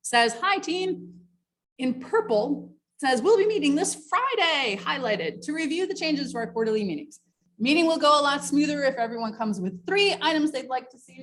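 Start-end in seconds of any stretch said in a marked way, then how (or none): 0.4-1.1 mock cheery email. Reads out a bad email. 3.2-4.8 mock cheery email. Reads out a bad email. 5.4-11.2 mock cheery email. Reads out a bad email.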